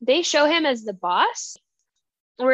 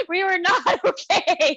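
laughing distress; 7 semitones higher than usual. Real, not acted. Upset at the collar scene.

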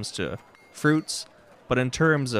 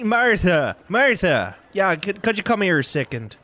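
calling across the market, higher and louder; 8 semitones higher, 5 dB louder than usual. Acted. Stallkeeper calls his neighbour Martha over, calling out loud. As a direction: shout a name over to the next stall.

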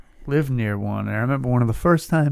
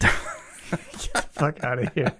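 laughing disbelief; much slower than usual. Real, not acted. Disbelieving laugh at gross story.

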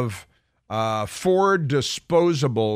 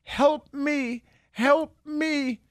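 high, far-off pleading cry of help me; silent plea for help; 14 semitones higher, much slower than usual. Retold or imitated. Clerk's horrified face pleading with the customer, high helpless cry. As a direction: voice her terrified face begging to be rescued. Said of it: "the look on her face was crying out".

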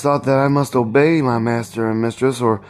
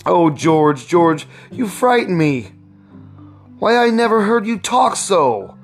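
high-pitched anxious wife; fearful, beseeching appeal to a husband; frightened pleading; 8 semitones higher than usual. A narrator's reading. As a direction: plead urgently, voice raised high in worry.

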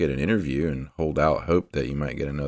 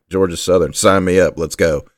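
louder, jumping at the offer; mock eager acceptance; 2 semitones higher, 6 dB louder than usual. Host voices coach grabbing first job offer, mock eager. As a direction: imitate a coach eagerly saying yes.

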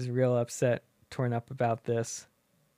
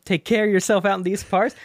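higher, louder mock scolding father; scolding cowboy dad; 6 semitones higher, 6 dB louder than usual. Dad lectures Woody after the snake, hammed-up scolding.

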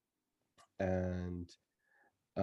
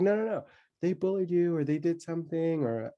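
insistent upset mother; 8 semitones higher than usual. Retold or imitated.

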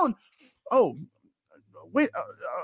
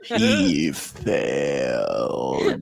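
deep, ponderous dramatic narrator voice; Patrick Page impression. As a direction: drag it out in a huge deep bass. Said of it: "talk like this in the Patrick Page voice".

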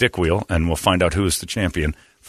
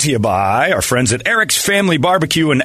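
higher-pitched promo announcer voice; podcast sponsor read; 8 semitones higher, 4 dB louder than usual. Real, not acted. Announcer signing off with thanks to barbecue sponsor, upbeat ad read. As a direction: punch out the sponsor tag.

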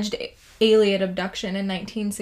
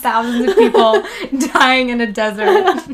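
laughing; 2 semitones higher, 6 dB louder than usual. Retold or imitated. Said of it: "He's like".

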